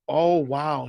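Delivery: ordinary delivery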